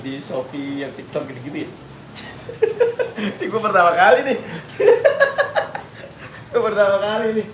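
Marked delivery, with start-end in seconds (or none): 2.6-6.5 laughing. Real, not acted. Embarrassed first livestream laugh. 6.5-7.5 laughing. Real, not acted. Embarrassed first livestream laugh.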